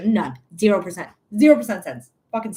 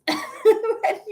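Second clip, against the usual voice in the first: high-pitched burst of laughter; shrill giggle; squealing laugh. Real, not acted. Laughs mid-rant.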